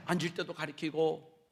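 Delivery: ordinary talk, nothing marked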